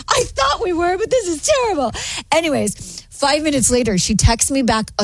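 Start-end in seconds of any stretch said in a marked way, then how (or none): 0.1-1.9 high-pitched, distressed reply; mock-distraught, voice pitched up; retold dismay. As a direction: act out mild panic in a high voice. Acting out her dismayed reply.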